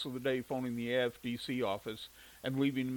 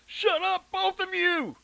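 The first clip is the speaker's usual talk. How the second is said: furious shout, much higher; angry yell; 8 dB louder, slower than usual. Acted. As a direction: scream at the kids. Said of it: "Shirley yelled".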